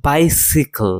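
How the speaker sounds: বাইসিকল with a short si; si pronunciation. Shown as a demonstration. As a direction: say বাইসিকল as bai-si-kl. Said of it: "এটা সাই না হয়ে সি হবে".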